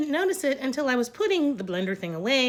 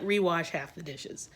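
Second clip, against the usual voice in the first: ordinary delivery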